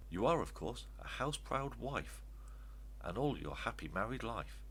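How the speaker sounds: ordinary delivery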